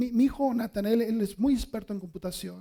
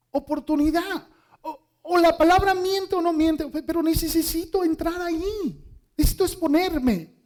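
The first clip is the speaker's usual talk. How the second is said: louder, higher preaching voice; 5 semitones higher, 5 dB louder than usual. Acted. Pastor urging the church to take risks, louder and higher. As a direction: proclaim it with conviction.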